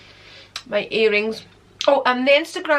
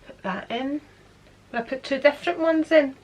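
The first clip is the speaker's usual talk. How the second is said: ordinary talk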